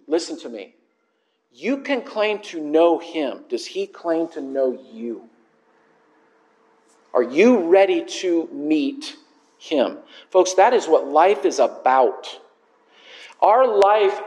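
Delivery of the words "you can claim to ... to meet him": ordinary talk, nothing marked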